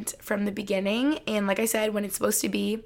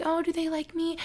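higher, softer anxious question; mock insecure worry; 8 semitones higher, 6 dB quieter than usual. Vlogger voicing a girl unsure of a boy, high and soft. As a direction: ask it small and high, fretting. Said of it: "that you're like".

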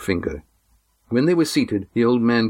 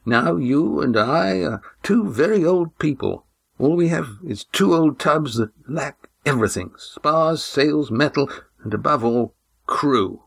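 testy; 6 semitones higher than usual. A narrator's reading. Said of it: "with the testiness of the very tired".

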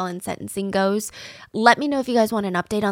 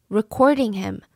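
ordinary talk

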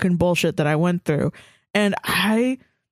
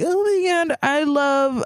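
high-pitched imitation of a gushing gossip; mock gossip; 8 semitones higher than usual. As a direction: play a gossipy coworker, pitched up.

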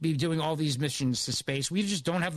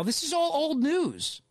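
much higher, slow, prissy mock voice; mocking Karine Jean-Pierre; 10 semitones higher, slower than usual. Host mocks press secretary's dismissal, high mock voice. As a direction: lampoon her brushing it off. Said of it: "she's out there saying to everybody".

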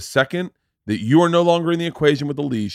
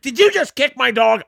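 much higher, louder shocked lady voice; outraged dog owner; 12 semitones higher, 5 dB louder than usual. Retold or imitated. Dad imitates the lady whose dog he tapped, outraged shriek. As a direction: play the appalled dog lady. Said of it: "the lady was like".

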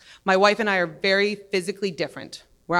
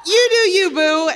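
sassy dismissal; 9 semitones higher, 5 dB louder than usual. Retold or imitated. Two women high and loud telling him go ahead. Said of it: "So we're both like".

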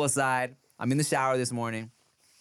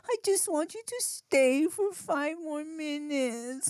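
high, slow mock crying kid; Veda; much slower than usual. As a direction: imitate a child's drawn-out tearful plea. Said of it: "She starts crying".

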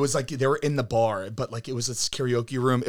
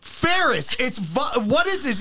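shocked; 10 semitones higher, slower than usual. Retold or imitated. Retold shocked reaction to vodka.

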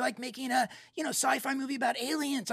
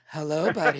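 suggestive greeting; 6 semitones lower than usual. Acted. Miss Farmer greets Buddy in the parking lot, playfully suggestive. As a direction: lower the voice for a knowing hello.

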